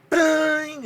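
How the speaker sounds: shouted high bang mimicking the bell; a big high yelled bang for the bell; mock bell bang. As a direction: belt out a bang like a loud bell. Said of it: "And I went".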